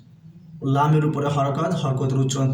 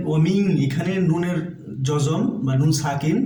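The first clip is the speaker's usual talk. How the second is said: usual speaking voice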